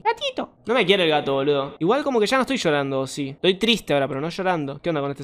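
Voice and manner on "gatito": very high squeaky call to the kitten; high-pitched pet call; cooing call. Real, not acted. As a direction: coo to lure the cat. Calling to the kitten.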